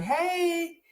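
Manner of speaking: put-on high, bright child's voice, calling out to strangers; imitating eager child. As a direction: mimic a little boy cheerfully greeting passersby. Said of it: "you were like".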